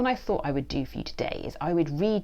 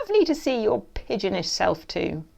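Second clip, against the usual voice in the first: sneering; 5 semitones higher, 6 dB louder than usual. A narrator's reading. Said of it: "he sneers".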